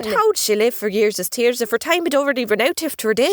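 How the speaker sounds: chatty Irish leprechaun voice; leprechaun storyteller. Acted. Leprechaun tells of his island, chatty Irish brogue. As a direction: spin the yarn as an Irish leprechaun.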